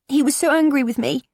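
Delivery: put-on high, strained teen voice on the verge of tears; a bullied girl pleading to be believed; tearful teenage girl. Daughter confiding bullying to mum, tearful and high.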